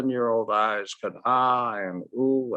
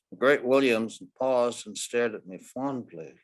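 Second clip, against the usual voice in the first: plain reading voice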